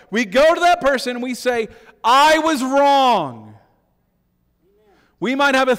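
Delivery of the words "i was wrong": slow, loud, every word hit; slow loud admission; emphatic confession. Shown as a demonstration. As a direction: declare it plainly and hard. Preacher models confession loudly.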